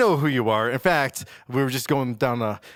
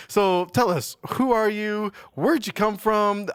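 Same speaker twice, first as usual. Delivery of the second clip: ordinary talk, nothing marked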